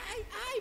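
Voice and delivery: a little teacup yelping in the fire's heat; teacup crying out. A narrator's reading. Preacher voices the teacup burning in the oven, high pained yelp. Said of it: "empieza a gritar la tacita".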